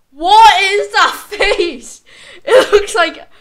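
laughing through the words, slowed; amused disbelief, words breaking into laughter. Real, not acted. Laughing at his old face.

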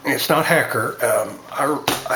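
lips clenched on pipe; muffled speech past pipe; talking around pipe stem. Real, not acted. Seen 2: Pipe stem is held between his lips from 0.8 s while speaking.

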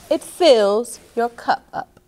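ordinary talk, nothing marked

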